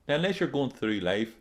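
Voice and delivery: usual speaking voice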